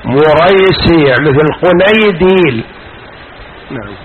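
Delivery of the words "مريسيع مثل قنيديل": مريسيع slowly as "mu-RAY-sii", matched to قنيديل "qu-NAY-diil"; slow teacher's model of مريسيع, rhyming its shape with قنيديل; diminutive pattern. Shown as a demonstration. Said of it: "نطقها نطق المصغر".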